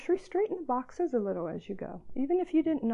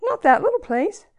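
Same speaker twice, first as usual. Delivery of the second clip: high, loud, pleading refusal with a catch of laughter; quick protest; 5 semitones higher, 8 dB louder than usual. A narrator's reading. Poem's speaker refusing the Father's task, high and pleading. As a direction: push back fast, a little desperate. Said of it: "I answered quickly".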